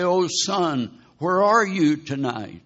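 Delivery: loud, high anguished plea to a lost son; grieving father's cry. Retold or imitated. Grieving father calling to his dead son, loud and high. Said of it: "and he'd say".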